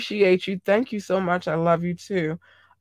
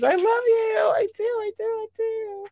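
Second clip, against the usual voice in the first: much higher, gushing squeaky voice; affectionate squeal. Real, not acted. Host gushing to her viewers in a squeaky high squeal. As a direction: squeal the love out like you can't contain it.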